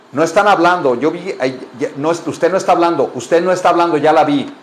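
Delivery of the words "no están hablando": usual speaking voice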